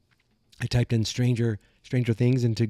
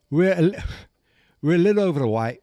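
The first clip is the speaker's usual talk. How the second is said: put-on loud, higher, faltering English accent; botched mock English accent; attempted English accent; 5 semitones higher, 5 dB louder, faster than usual. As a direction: try an English accent and crack up. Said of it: "I lost it".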